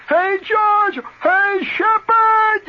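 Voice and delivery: loud high call across the back yard; hollering. Retold or imitated. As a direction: yell his name out from the back. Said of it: "you holler out in the back".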